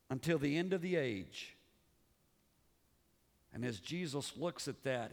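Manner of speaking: ordinary delivery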